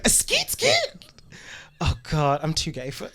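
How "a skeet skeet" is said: high squealed skeet skeet; [name] ad-lib. Retold or imitated. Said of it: "Miss [name]".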